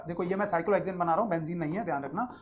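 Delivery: ordinary talk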